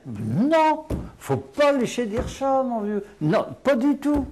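high, emphatic denial, pitch jumping up; indignant protest. Real, not acted. Teacher rejecting that patois is deformed French, indignant and high. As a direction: rebut the claim with rising heat.

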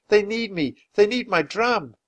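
high, pleading and distraught. Acted. Drummer boy pleading with Annie, distraught. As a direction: insist through rising panic. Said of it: "He sounded more and more upset".

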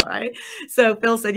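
ordinary talk

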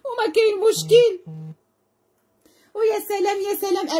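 high squealing sing-song hype; excited squeal. Real, not acted. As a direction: squeal the praise way up high like a thrilled saleswoman. Live seller hyping a ring.

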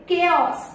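"केओस" with a hard k start; KAY-os for "केओस"; correct pronunciation. Shown as a demonstration. Trainer correcting the guesses, correct pronunciation. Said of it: "इसे हम लोग बोलेंगे".

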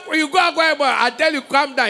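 high, loud scolding; retold pastor warning loudly; stern warning. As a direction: warn him off firmly. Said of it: "I said".

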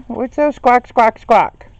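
loud, high squawking parrot imitation; mock parrot call; parrot squawk mimic. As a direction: squawk the words back like the bird. Mimicking the bird again.

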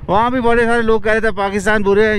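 ordinary talk, nothing marked

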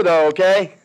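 ordinary talk, nothing marked

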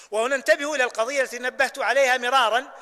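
normal talking voice

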